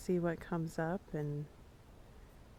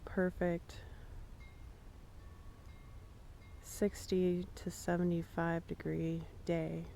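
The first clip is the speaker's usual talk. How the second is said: calm, unhurried guiding voice with long pauses; guided meditation. Acted. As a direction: ease listeners into the grass image. Leads a shared guided meditation.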